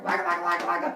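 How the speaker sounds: loud, rapid monster gibberish; little monsters. Retold or imitated. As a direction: imitate small monsters attacking with jabber. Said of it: "And the little monsters".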